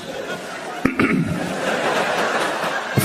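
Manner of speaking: a pointed throat clear that breaks the sentence; throat clear mid-sentence. Shown as a demonstration. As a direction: clear your throat right in the middle of the line. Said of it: "don't clear your throat in the middle".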